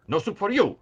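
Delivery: high, loud outburst of refusal; Soup Nazi. Acted. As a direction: shout it like an indignant tyrant. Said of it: "like the soup Nazi".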